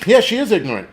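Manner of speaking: ordinary delivery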